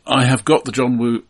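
ordinary delivery

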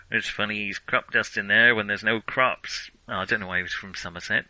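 ordinary talk

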